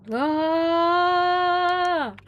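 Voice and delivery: long high held squawk; a sustained strained squawk. Shown as a demonstration. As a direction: hold out a squawk. Practicing squawk.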